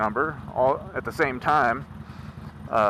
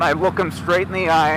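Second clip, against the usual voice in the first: normal talking voice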